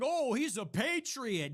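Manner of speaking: mocking naive fans. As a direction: ham up gullible admiration, drawn out. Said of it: "people are going".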